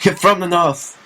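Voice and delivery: high-pitched, laughing protest; mock incredulous outcry. Friend echoing the retort to a refuser of accents, mock incredulity. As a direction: exclaim it in comic disbelief.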